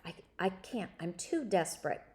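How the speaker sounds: ordinary delivery, nothing marked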